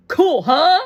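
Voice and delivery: high, loud excited cry; excited call. A narrator's reading. Shake hearing the ice cream truck, loud excited call. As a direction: call out, thrilled.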